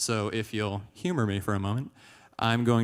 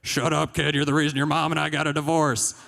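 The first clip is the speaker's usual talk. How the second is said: raised, higher-pitched fed-up father; angry dad; 5 semitones higher, 4 dB louder than usual. Acted. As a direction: snap back at the pestering kid. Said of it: "And he'd be like".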